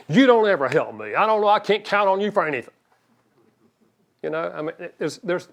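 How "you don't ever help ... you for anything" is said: louder, quicker accusing outburst rising high; harsh complaint. Retold or imitated. Wife accusing husband of never helping, harsh. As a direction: snap it at him like a grievance. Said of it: "It'd be far different if you went in there and said".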